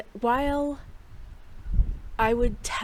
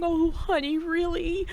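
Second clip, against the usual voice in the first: high-pitched, imitated motherly concern; consoling mother-in-law; 9 semitones higher than usual. Mother-in-law consoles crying daughter-in-law, high imitated concern. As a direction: coo it high, as if comforting someone upset. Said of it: "trying to console me".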